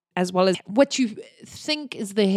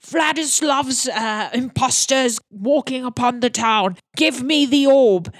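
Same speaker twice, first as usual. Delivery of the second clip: angry orc priest; 4 semitones higher, 4 dB louder than usual. Acted. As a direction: bark orders like an impatient orc. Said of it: "And the orc says".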